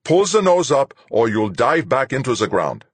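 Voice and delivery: sharp warning. A narrator's reading. Fuchs warns the pilot about diving into the ground, sharp. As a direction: warn sharply, high and loud. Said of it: "Fuchs said sharply".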